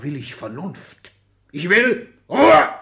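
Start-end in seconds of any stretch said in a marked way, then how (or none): 1.5-2.7 slow, much louder and higher roar; furious shout. Acted. Father silencing his children with a furious shout. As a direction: bellow the command.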